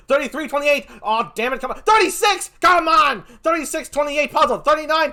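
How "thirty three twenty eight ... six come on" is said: ordinary talk, nothing marked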